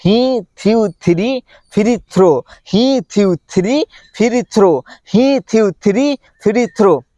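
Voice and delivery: each word split off with pauses. Shown as a demonstration. Teacher slowing down word by word for learners. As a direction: break it into single words. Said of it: "धीरे-धीरे बोलूं मैं क्या?".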